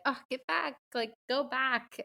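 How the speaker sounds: imitating her childhood self begging for the scene to switch; impatient kid. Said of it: "I'd be like".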